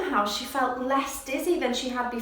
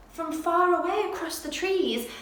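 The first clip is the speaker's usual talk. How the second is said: ordinary delivery, nothing marked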